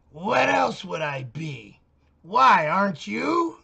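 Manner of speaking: put-on loud, slow, sneering voice for the young neighbor; mocking neighbor woman. Man mimicking the neighbor woman's retort, loud and sneering. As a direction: play the girl huffy and sarcastic, dragged out.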